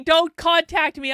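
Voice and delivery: loud, high, slow joking plea; mock warning.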